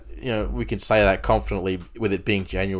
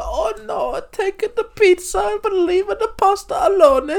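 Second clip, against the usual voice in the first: very high hammed-up Italian accent; jolly italian pizza chef; 4 dB louder than usual. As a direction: squeal it like a cartoon Italian. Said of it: "And they go".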